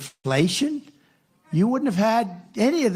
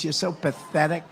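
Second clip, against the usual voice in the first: usual speaking voice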